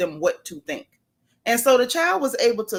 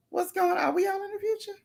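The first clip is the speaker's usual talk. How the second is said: much higher, softer, fast half-crying wail; mock distress; 9 semitones higher, 6 dB quieter, faster than usual. Real, not acted. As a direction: whimper it in comic panic. Reacting to a chat comment.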